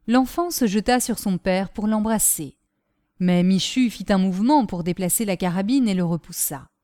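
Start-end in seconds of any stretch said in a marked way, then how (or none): none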